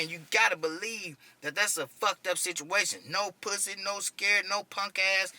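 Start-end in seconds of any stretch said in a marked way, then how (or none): none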